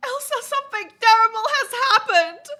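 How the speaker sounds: gasp, then high, loud melodramatic wail; hammed-up crying mom. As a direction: break mock-tragic news to your daughter. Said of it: "Why are you crying".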